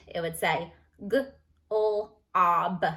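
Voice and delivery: g-l-ob stretched very slowly; sounding out short o. Shown as a demonstration. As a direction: drag out g-l-ob sound by sound. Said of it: "If I didn't put the E".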